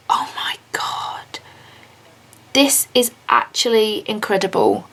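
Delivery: normal talking voice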